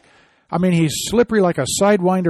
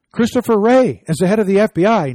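raised declaration; 5 semitones higher, 5 dB louder than usual. Real, not acted. Host announcing his conclusion.

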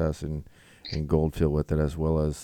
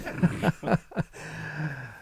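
high laugh; 11 semitones higher than usual. Real, not acted. Keeps laughing.

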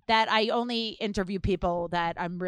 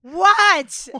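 much higher, much louder mock confused "What? What?"; confused outsider; 9 semitones higher, 14 dB louder than usual. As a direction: play the left-out friend who can't get the joke. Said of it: "and I'm immediately like".

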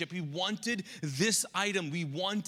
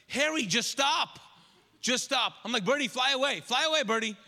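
raised urgent calls to the animals; calling out; 5 semitones higher, 4 dB louder than usual. Retold or imitated. Owner calling to cat and bird in the yard, urgent. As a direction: shoo them off, pleading.

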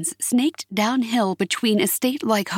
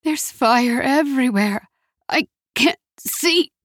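high, halting distressed voice; choked mother's plea, slowing at the end; coughing distress; 5 semitones higher than usual. A narrator's reading. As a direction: struggle to get the words out. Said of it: "Mother coughed".

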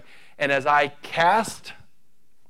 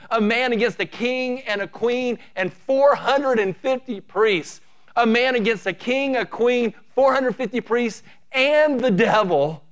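stack each enemy higher; building fervour; 7 semitones higher than usual. Acted. Preacher recounting Elijah's odds to the church, mounting fervent build.

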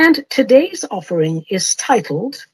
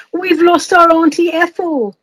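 mock despair; 6 semitones higher than usual. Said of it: "a family cried out in despair".

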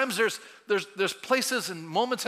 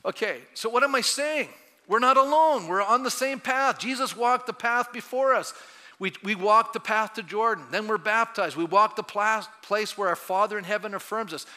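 louder, higher preaching voice; 3 semitones higher, 4 dB louder than usual. Acted. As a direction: lift the room with rising conviction. Preaching raised voice.

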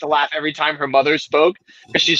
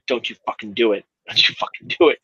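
low, hushed mock warning; low, stern retold warning; imitated warning girlfriend; 7 semitones lower than usual. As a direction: mimic a girlfriend warning under her breath. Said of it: "my girlfriend, she was like".

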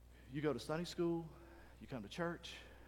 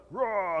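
mock roar; 3 semitones higher, 6 dB louder than usual. Bodybuilder roar, lifting weights.

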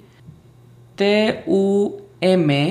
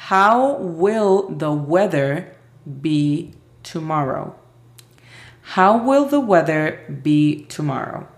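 very slow, careful English; slow model question; much slower than usual. Shown as a demonstration. Teacher giving the last question, slow and clear. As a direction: drag each word out for learners. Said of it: "La última es".

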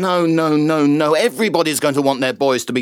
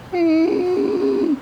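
squeaky high hum; a shrill little hum for the soup. Shown as a demonstration. Showing a stranger his soup impression, a high hum. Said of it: "show you my cream of mushroom".

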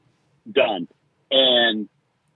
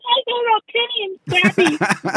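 much higher, slow, thin tinny voice; slower than usual. Retold or imitated. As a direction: sound like a cheap tin mic. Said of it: "And then I sound like".

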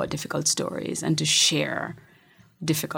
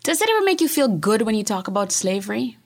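daughter's question; 6 semitones higher than usual. Retold or imitated. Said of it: "And then she goes".

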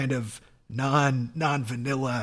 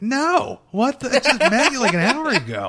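high, loud mock disbelief; acted indignant refusal; 10 semitones higher, 6 dB louder than usual. Acting a new hookup refusing the request, high indignant disbelief. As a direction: balk in hammed-up outrage.

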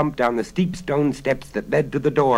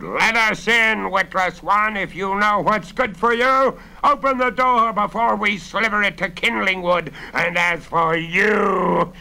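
high, loud menacing bellow; robbers' bellow; 8 semitones higher, 5 dB louder than usual. A narrator's reading. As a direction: bellow threats at a barred door. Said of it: "the robbers bellowed".

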